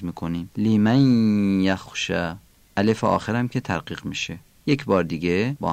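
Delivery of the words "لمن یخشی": لمن glides into یخشی on a long nasal y; nasal merging into ya. Shown as a demonstration. Teacher repeating لمن یخشی for learners, long ghunnah merge. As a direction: stretch the join of لمن یخشی through the nose. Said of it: "نون رو کشیده با غنه در یا".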